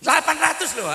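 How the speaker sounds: high, loud and astonished; amazed exclamation. Real, not acted. Preacher wowing the congregation, high and loud. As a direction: marvel at the huge number. Preacher marvels at plane capacity.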